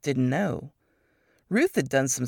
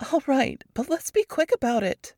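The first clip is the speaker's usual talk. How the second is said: higher, hurried reluctant agreement; Varys reluctant; 9 semitones higher than usual. A narrator's reading. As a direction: agree but rush her.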